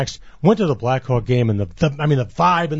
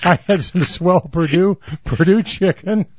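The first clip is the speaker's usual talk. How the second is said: laughing; 5 semitones higher than usual. Real, not acted. Laughing at his own cooking.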